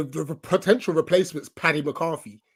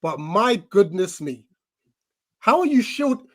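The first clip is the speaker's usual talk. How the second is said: ordinary talk, nothing marked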